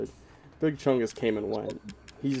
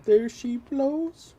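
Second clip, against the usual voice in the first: mock sea-shanty call; 12 semitones higher, 3 dB louder, much slower than usual. Joking whale rhyme.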